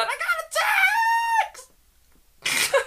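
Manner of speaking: very high parody squeal; mock screaming. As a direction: shriek it like a thrilled contestant. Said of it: "they just start screaming".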